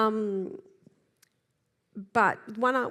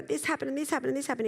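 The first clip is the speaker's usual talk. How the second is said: rushed, higher-pitched outpouring; upset venting; 4 semitones higher, much faster than usual. Acted. Coach acting an upset person telling someone, rushed venting. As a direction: pour it out fast, mock-upset. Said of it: "If you were really upset".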